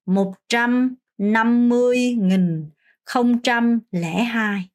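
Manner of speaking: slow, careful Vietnamese number; slow model reading. Shown as a demonstration. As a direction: read the number out slowly. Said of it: "이 숫자를 요렇게 읽습니다". (천천히 또박또박 숫자 읽기)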